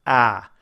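"Ah" with the mouth open three fingers wide; held open "Ah" vowel; ah vowel demo. Shown as a demonstration. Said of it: "working on this one".

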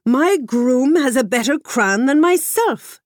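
jealous king complaining about his groom, indignant; put out king. A narrator's reading. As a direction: let the king bristle with wounded pride. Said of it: "much put out by the whole matter".

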